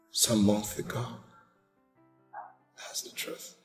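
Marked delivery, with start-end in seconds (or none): 2.8-3.5 whispered confession. Acted. Confessing husband.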